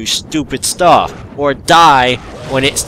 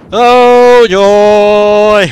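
sarcastic oh joy; 10 semitones higher, 10 dB louder than usual. Real, not acted. Gamer sarcastic at a monster.

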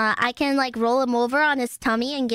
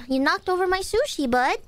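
ordinary talk, nothing marked

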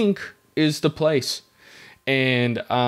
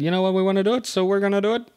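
higher-pitched, rising resolve; eager group decision; 7 semitones higher, much faster than usual. Retold or imitated. Recalling buddies agreeing to try snowboarding, eager. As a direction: pipe up with naive determination.

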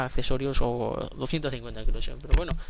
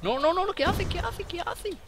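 shocked disbelief. Real, not acted. Enemy appears, shocked disbelief.

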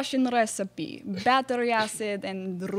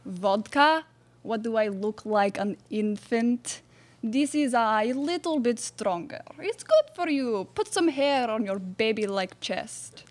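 mock Russian accent. Russian bachelorette Ivanka.

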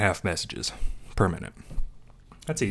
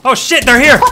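high, loud startled yell; alarmed shout; 12 semitones higher, 11 dB louder than usual. Real, not acted. Enemies appear in game.

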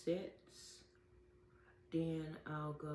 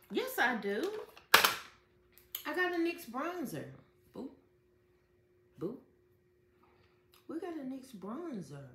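excited; 6 semitones higher, 7 dB louder than usual. Real, not acted. Finds the wanted bronzer.

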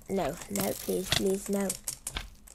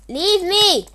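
loud pleading shout, pitch higher; desperate shout; 7 semitones higher, 9 dB louder than usual. Real, not acted. Player begging the chasing attacker, loud desperate shout. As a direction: beg loudly to be left alone.

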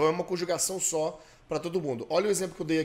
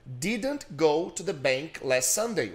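slow, deliberate drill read; slow model sentence; much slower than usual. Shown as a demonstration. Teacher models a negative sentence, slowly. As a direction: pace it out for repetition.

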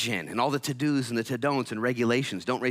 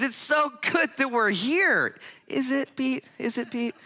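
1 mock excited Peter; 4 dB louder, slower than usual. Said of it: "And Pete says".

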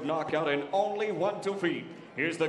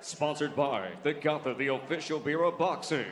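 usual speaking voice

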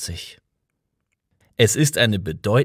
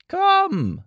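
high call, a bit louder; 3 dB louder than usual. A narrator's reading. Old man calling Arthur to come, a high call. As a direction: call him over from afar. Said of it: "rief der Alte".

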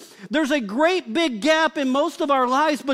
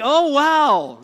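mock gasp of amazement; 6 dB louder than usual. Imitating ladies at the mirror.

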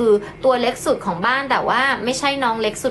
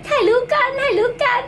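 much higher, cooing to a kitten; baby talk to pet; 12 semitones higher than usual. Acted. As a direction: coax the kitten sweetly for a photo. Coaxing cat for photo. (เสียงเล็กเอาใจแมว)

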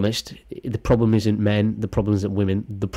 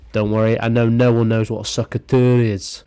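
usual speaking voice

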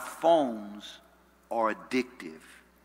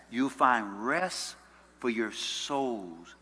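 slow scripture reading, settling softer at the end; 3 semitones lower, 3 dB quieter, much slower than usual. Real, not acted. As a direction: stretch the words out unhurriedly. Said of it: "I wanna read it slowly".

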